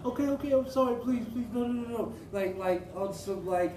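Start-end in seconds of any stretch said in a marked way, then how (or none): 0.0-2.1 high, pleading mock sobbing; crying plea. Imitating the woman begging him, high mock crying. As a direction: imitate her begging through tears, pitched high. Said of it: "she just started crying and was like".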